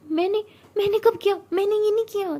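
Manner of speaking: high, loud indignant protest; shocked denial. Acted. Siya denies biting Tara, loudly indignant. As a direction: deny it with voice shooting up.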